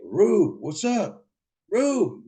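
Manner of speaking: acted high-pitched holler; calling out. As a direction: call out to someone behind a door. Calling through door to brother.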